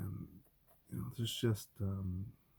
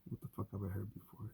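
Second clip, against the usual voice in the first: ordinary talk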